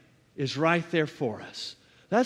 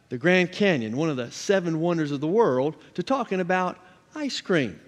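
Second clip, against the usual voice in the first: ordinary talk, nothing marked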